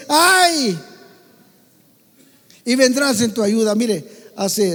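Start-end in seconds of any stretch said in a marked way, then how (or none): 0.1-0.8 high loud "¡Ay!" cry; cry for help. Shown as a demonstration. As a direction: call out "¡Ay!" like a hurt person. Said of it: "solamente di".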